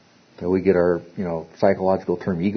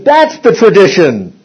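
higher and louder, slowed, insisting on tradition; mock squabbling insistence; 9 semitones higher, 8 dB louder, slower than usual. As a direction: bark it like a rabbi's student in a squabble. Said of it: "squabbling and barking and biting".

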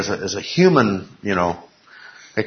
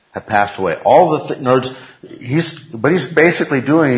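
ordinary talk

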